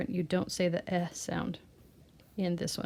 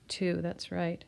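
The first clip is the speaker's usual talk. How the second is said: usual speaking voice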